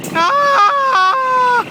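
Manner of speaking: very high, very loud scream; hammed-up scream of alarm; playful shriek; mock scream. Pretending to be run over.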